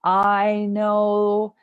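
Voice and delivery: ordinary talk, nothing marked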